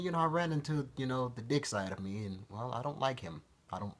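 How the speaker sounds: normal talking voice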